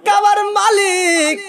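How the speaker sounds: wailing plea. Acted. Grandfather begs God for his daughter-in-law, wailing plea. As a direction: cry out to God in a pleading lament.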